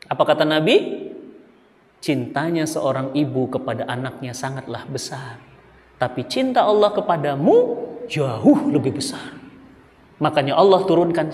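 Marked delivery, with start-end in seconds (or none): 2.0-9.4 softer, lower, tender reassurance; gentle Prophet's words. Acted. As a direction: comfort the grieving mother. (lembut dan menenangkan, lebih pelan)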